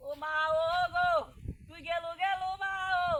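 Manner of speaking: drawn-out wailing lament; hammed-up keening for a dead wife; theatrical crying. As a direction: wail with long held vowels. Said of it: "কান্দিস কে".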